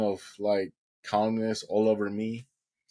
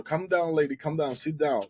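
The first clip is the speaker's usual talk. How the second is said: higher, firm calming voice; doctor calming her; 6 semitones higher than usual. Retold or imitated. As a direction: settle her down, firmly.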